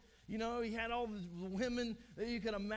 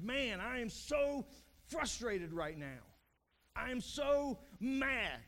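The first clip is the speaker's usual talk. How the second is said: mock exasperation, hammering a drawn-out, raised SO; acted frustration; 4 semitones higher, 4 dB louder, slower than usual. Preacher voices a frustrated person to the congregation, exasperated. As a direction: act fed up, leaning slowly and loudly on the key words. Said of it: "if you've ever said the words".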